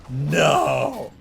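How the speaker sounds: shocked no. Real, not acted. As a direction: blurt it out in disbelief. Reader exclaims at reveal.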